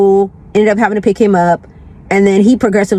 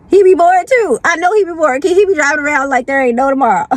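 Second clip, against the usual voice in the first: playful high teasing voice; higher teasing voice; 7 semitones higher, faster than usual. Acted. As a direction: tease the neighbor in a raised voice. Teasing about her neighbor.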